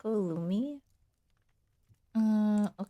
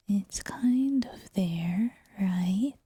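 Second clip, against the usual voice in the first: whisper; 2 semitones lower, 5 dB louder, much slower than usual. Shown as a demonstration. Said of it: "head voice whispering".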